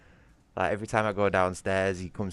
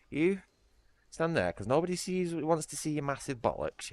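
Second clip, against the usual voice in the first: high mock-scolding pet voice; talking to the cat; 10 semitones higher than usual. Real, not acted. Streamer teasing his cat in a high, playful scolding voice.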